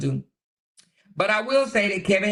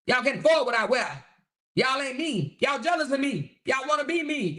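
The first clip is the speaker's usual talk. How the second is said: higher, gloating mock voice; mock Kevin bragging to his critics, higher and gloating; mocking boastful Kevin; 8 semitones higher than usual. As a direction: lord it over everyone like a show-off. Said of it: "He said".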